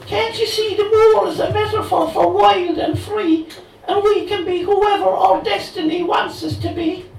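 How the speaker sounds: Cathy, high and loud in bad Cork brogue; dodgy Cork accent. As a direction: gush at him in a hammed Cork lilt. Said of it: "delivered in a dodgy Cork accent".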